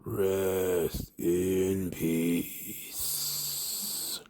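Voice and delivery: deep, extremely drawn-out imitation; slow, low wrestler catchphrase, trailing away; Undertaker. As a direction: drag every word out very low and slow. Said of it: "then he goes back to".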